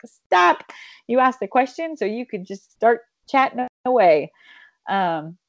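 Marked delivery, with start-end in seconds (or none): 0.0-4.3 coaching stop. Retold or imitated. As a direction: cut them off like a firm coach. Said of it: "I'm like".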